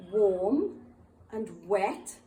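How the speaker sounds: "Warm" e "wet" ditos curtos, numa só batida; short single beat on "Warm" and "wet"; one-syllable words. Shown as a demonstration. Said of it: "have one syllable".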